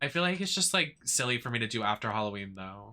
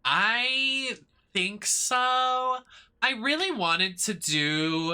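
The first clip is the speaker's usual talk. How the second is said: sing-song drawl; 10 semitones higher, much slower than usual. Real, not acted. Drawn-out high sing-song.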